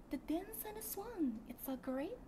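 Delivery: high, slow, sweet game heroine voice; Odet voice. Shown as a demonstration. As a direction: purr it like a graceful anime heroine. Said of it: "Aku mau contoh deh". (suara Odet yang tinggi dan manis)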